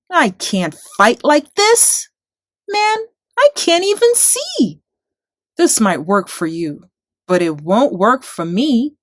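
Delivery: put-on frustrated boy. A narrator's reading. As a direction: squirm and protest in heavy armour.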